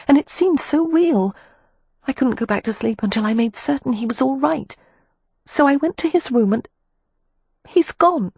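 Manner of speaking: put-on high, worried girlish voice; anxious and pleading, pitched high; anxious young woman. A narrator's reading. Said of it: "Poppy said anxiously".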